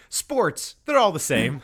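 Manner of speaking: lightly mock the clueless owner's shrug; imitating the owner. Mocking the Pelicans owner's attitude, put-on dismissive voice. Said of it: "they're like".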